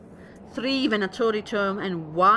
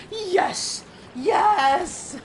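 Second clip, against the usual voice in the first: high, loud real whoop of triumph; excited cheer; 10 semitones higher, 4 dB louder than usual. Real, not acted. Streamer finally earns the tome after three streams, cheering for real. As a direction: celebrate finally getting the loot.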